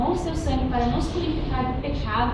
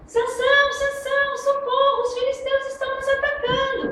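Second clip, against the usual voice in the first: mock cry for help. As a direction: scream for Samson as if attacked. Dalila finge pedir socorro. (grito agudo de socorro fingido)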